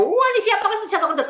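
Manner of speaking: high, loud mock uproar in the meeting; hammed-up crowd yelling whodunit; commotion outcry. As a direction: act out the noisy crowd asking who did it. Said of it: "Nah, itu heboh".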